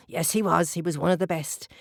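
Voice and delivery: plain reading voice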